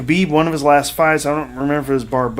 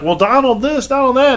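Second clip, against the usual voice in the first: high, whiny mock protest; mocking rival politicians; 11 semitones higher, 3 dB louder than usual. Rivals complaining to Trump on stage, high mocking whine. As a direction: squeal it like a flustered politician. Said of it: "they'd be like".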